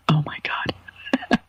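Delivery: slow, breathy whispered swoon; real whispered gush about a comedian she loves. Real, not acted. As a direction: whisper it like a starstruck fan. Swooning over Bill Burr.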